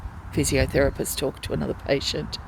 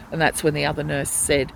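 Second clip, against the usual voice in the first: ordinary delivery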